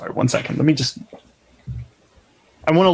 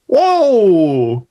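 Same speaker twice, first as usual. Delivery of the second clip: surprised whoa; 2 semitones higher, 4 dB louder than usual. Acted. Mock shock at mutiny idea.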